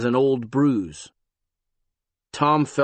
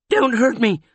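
frightened high plea, louder; pleading; 11 semitones higher, 5 dB louder than usual. A narrator's reading. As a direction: beg not to be hurt. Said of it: "a voice pleaded".

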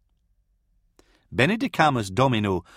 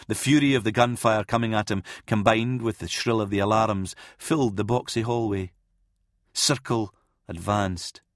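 ordinary delivery

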